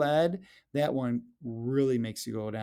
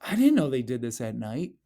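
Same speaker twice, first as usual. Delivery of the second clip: ordinary talk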